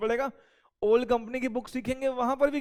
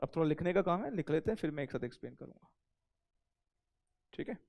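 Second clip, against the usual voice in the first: ordinary delivery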